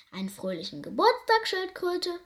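lightly voiced rhino, puffing out the greeting; a hint of a snorting rhino; mild huff of a big animal; huffing rhinoceros. A narrator's reading. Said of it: "schnaufte es".

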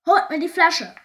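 high, very loud captain's bellow; furious pirate captain yelling; roared command. A narrator's reading. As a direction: bark the order at the crew. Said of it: "brüllte Knitterbart".

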